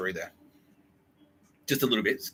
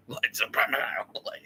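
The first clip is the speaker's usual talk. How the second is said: usual speaking voice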